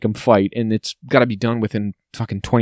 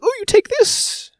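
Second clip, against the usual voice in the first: much higher, slow, airy falsetto; mock Capitol stylist; slower than usual. Imitating a stylist handing her something, in a slow falsetto. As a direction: hand it over with fake sweetness.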